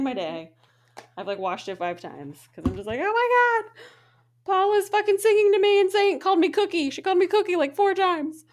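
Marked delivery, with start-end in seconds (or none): 3.0-8.4 high, loud and giddy; giddy gushing. Real, not acted. Gushing to her co-host about Paula, high and giddy. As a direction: squeal it out with delight.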